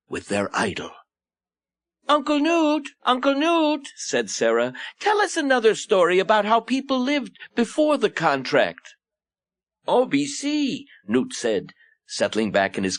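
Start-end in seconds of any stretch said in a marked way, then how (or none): 2.1-3.9 put-on high-pitched little child voice; high, sing-out child's plea; pleading orphan child. Orphan child begging for a story. 5.0-8.9 put-on high-pitched child begging for a story; small child's high voice; eager orphan child. 9.9-10.9 high, drawn-out reply; slow high-pitched storyteller's exclamation; indulgent Uncle Newt. Acted. Newt answers the orphans' request.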